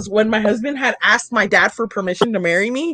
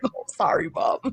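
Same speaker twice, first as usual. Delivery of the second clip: small, soft, lower voice; sheepish apology; 5 semitones lower, 9 dB quieter than usual. Retold or imitated. Daughter apologizing to her scolding mom, sheepish. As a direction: shrink back and say sorry softly.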